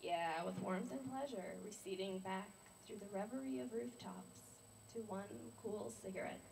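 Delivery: measured poem reading with weighted phrases and pauses; poetry recital. Acted. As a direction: recite it to a live audience, lingering on key words. Recites own poem to audience.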